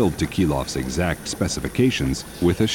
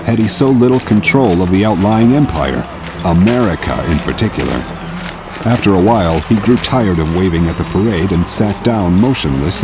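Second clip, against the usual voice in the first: louder, stately story narration; grand narration; 5 dB louder than usual. A narrator's reading. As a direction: proclaim it like a newsreel. Grand narration over parade.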